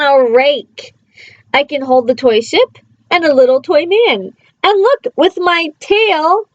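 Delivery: lively read-aloud, playful bounce on the rhymes; reading to children. Acted. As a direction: read it like a bedtime picture book. Reading a picture book aloud.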